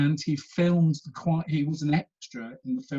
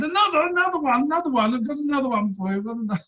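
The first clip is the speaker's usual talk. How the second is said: much higher, louder eager pleading; eager old man; 10 semitones higher, 4 dB louder, faster than usual. Retold or imitated. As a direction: beg her to stay for one more. Eager old man, mouth organ.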